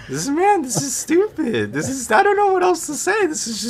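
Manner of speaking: exasperated outburst, way up high. Real, not acted.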